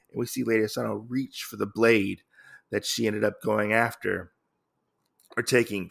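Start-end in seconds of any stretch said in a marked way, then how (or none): none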